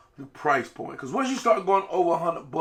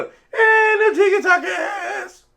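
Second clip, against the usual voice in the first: squealed high and slow with a laugh; high silly shout; 6 dB louder, much slower than usual. Real, not acted. Silly yelled sign-off.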